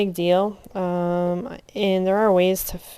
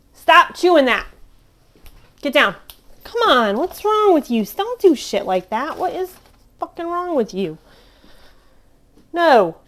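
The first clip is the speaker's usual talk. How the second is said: scolding; 9 semitones higher, 7 dB louder than usual. Real, not acted. Scolding the cat.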